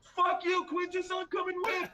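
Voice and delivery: excited guard. Acted. Guard running off after his mate, high and gleeful. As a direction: squeal it like a guard ditching his post.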